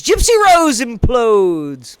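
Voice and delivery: slow, stretched-out proclamation; theatrical announcement. Pretend. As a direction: draw each word out in a put-on voice. Repeats the headline theatrically.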